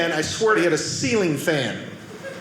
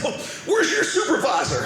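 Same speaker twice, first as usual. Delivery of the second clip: imitating complaining customer; 9 semitones higher than usual. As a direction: demand it high, like an offended customer. Retold customer demands the supervisor.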